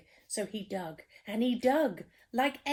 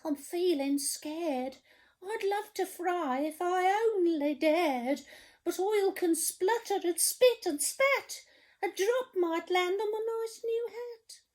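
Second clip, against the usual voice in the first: high, trembling scared cook voice; 11 semitones higher than usual. Acted. Storyteller voicing the cook, scared of the sea, high voice. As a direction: quiver like a frightened wimp. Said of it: "the cook declared".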